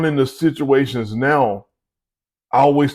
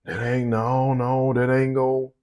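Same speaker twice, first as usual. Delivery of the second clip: put-on softer, slow old-timer voice; old naysayer; 6 dB quieter, slower than usual. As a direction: grumble it like a stubborn elder. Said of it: "The ones that's sixty, seventy years old".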